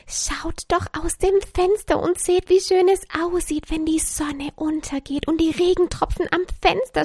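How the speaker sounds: high, upbeat little speech; happy, highly motivated. A narrator's reading. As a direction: gush confidently as Joy, drawing out the last words. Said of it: "Selbstbewusst und glücklich stand sie da und begann hochmotiviert".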